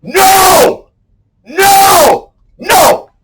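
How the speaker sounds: real cathartic yells; primal shout. Real, not acted. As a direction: throw your whole weight into each no. Releasing no energy with full weight.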